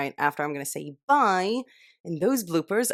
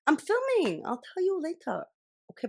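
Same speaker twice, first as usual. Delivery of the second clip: high-pitched aside; 11 semitones higher than usual. Real, not acted. Shushing someone offscreen, high.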